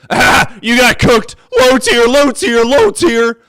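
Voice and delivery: high, loud, drawn-out taunting cry; mock a gloating troll at full volume; jeering troll. Host mimicking Phil bros who were called out, jeering loudly. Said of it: "they immediately".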